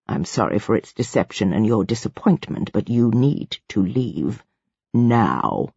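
deep, soft, measured verdict; low, controlled authority figure; imperious ruler. A narrator's reading. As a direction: lay it down coldly in a low voice. Ruler's verdict, voiced by narrator.

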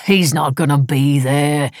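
slow, dismissive scoff. A narrator's reading. Husband dismissing wife's worry about a guest, scoffing. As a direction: brush the idea off, drawn out. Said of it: "Sir Owen scoffed".

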